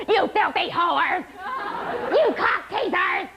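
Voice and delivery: high, slow, stretched-out yell; mock yelling. Woman yelling insults at bingo players, mock outburst. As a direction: imitate a drawn-out outburst, word by word. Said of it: "And then she'd yell".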